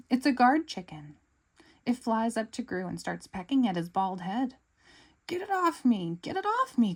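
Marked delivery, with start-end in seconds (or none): 5.3-7.0 high, loud panicked yelp; panicked cry. Acted. Gru yelling at the chicken to get off, panicked. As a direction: yelp it, a bird is pecking your head. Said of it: "cries Gru".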